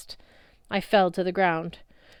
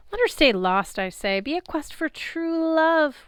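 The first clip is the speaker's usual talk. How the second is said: high, mocking goddess brushing off the hunters; lightly voiced vain love goddess, bored then dreamy; dismissive goddess; 4 semitones higher, 4 dB louder than usual. A narrator's reading. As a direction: scoff at the quest, then swoon over true love.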